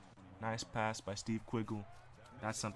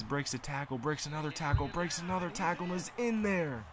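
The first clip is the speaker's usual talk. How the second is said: each tackle climbs higher and louder to a peak; building excitement; 6 semitones higher, 4 dB louder than usual. Real, not acted. Commentator calling a runner breaking tackles, rising to excited peak. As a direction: ramp up with every broken tackle until it explodes.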